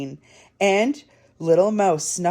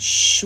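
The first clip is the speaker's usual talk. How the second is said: long hushed shh; shushing; 3 dB louder than usual. A narrator's reading. Storyteller hearing a new noise, hushing. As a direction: shush to listen.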